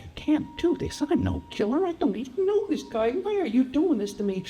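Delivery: high, desperate protest; frightened high-voiced refusal; panicked pleading. Terrified man refusing to kill.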